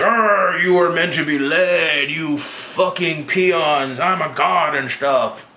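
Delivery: slow, drawn-out mock-grandiose speech; hammed-up tyrant proclamation; mock villain. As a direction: stretch each word like a pompous god. Voicing Loki addressing the crowd.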